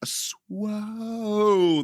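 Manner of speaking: loud, high hyped intro, stretched title; hyped announcer. Acted. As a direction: hype the crowd up.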